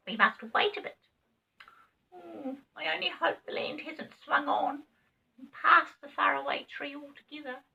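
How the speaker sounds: high, fretful little rabbit; worried rabbit. A narrator's reading. Rabbit fears the land swung past, high and fretful. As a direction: fret in a squeaky voice. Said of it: "rabbit worries aloud".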